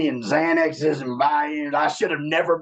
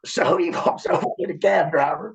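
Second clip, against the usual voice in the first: ordinary talk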